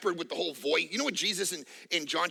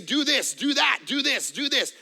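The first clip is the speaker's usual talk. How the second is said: commanding voice; 5 semitones higher, 4 dB louder than usual. Retold or imitated. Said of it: "speak to you and say".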